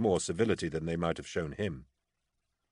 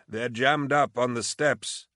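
put-on higher, slow voice of an old tailor; elderly tailor; 6 semitones higher, slower than usual. A narrator's reading.

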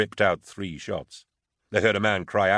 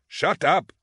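sharp, much higher and louder; snapped; 10 semitones higher, 5 dB louder than usual. Acted. Flustered guard snapping at the talkative prisoner, angry. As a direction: cut him off hard. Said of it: "Zamak snapped".

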